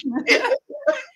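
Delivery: a short, very high laugh; real laugh squeaking up high. Real, not acted. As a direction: let a delighted laugh pop out. Laughs at friend's pitch.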